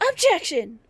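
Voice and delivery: lightly voiced objection; demo objection. Shown as a demonstration. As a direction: show how it's shouted. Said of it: "Like that".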